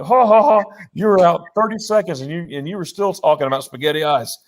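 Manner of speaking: high, amused retelling of teasing doctors; mock laugh, then tease through amusement; imitated cracking up. Doctors laughing at waking patient, imitated cracking up. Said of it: "they're cracking up".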